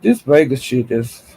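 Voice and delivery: normal talking voice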